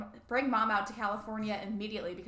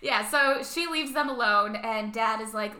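normal talking voice

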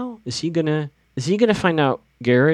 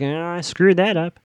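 higher-pitched imitation of a rueful groan; mock regret; 6 semitones higher than usual. Paige kicks herself after the rejected kiss, mock regret. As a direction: play up the rueful self-reproach, mockingly.

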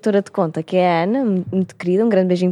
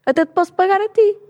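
much higher, gleeful offer; excited; 11 semitones higher than usual. Pretend. Speaker imagining treating her friend to a spa, high and excited. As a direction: squeal it out, delighted.

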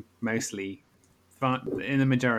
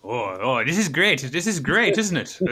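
excited; 3 semitones higher, 6 dB louder than usual. Real, not acted. Delighted by the gossip.

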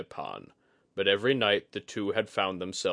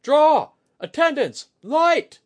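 very high, very loud short orders; barked commands; 13 dB louder, slower than usual. A narrator's reading. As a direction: snap each order like a drill sergeant. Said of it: "practiced from shouting across the din of battle".

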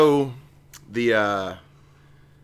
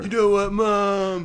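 mock sulky son; 10 semitones higher, 3 dB louder, slower than usual. Voicing poet sulking at mother.

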